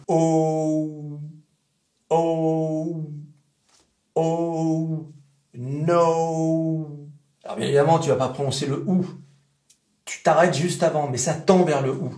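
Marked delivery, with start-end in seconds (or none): 0.1-6.9 O held long, then no, drifting toward OU; slow vowel drill. Shown as a demonstration. Teacher slowly modelling the O for students. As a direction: draw each O out slowly toward OU. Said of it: "Écoute bien".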